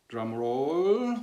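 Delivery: drawn-out drum roll, rising and swelling; playful suspense. Pretend. Teacher teasing the name of the ancient technique, playful suspense. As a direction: stretch it upward to tease the reveal.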